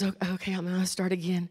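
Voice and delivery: soft, low aside, imitating a flustered child; quietly regroup as the nervous child; nervous little girl. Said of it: "she got a little nervous".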